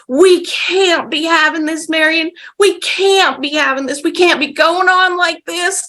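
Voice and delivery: high whiny mock wail; half whiny crying. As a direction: imitate a man half crying, whining upward. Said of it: "sort of half whiny crying".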